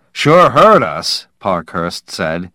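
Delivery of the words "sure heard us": raised, slow booming voice; loud call. Acted. Parkhurst boasting to crew about the loud landing, loud.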